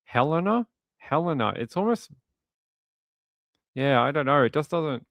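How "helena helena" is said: Helena as HEL-uh-nuh, stressed up front; odd-sounding pronunciation. Shown as a demonstration. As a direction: test out Helena with a short stressed HEL, first rising, then falling. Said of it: "sounds odd to me to say it that way".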